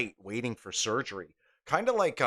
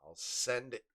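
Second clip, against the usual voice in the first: ordinary talk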